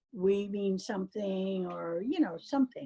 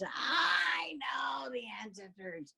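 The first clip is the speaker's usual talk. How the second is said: slow, hushed spooky whisper; mysterious whisper; 3 semitones higher, slower than usual. Retold or imitated. Mother telling daughter about the ancestors, slow whisper. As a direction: breathe it like a secret from beyond.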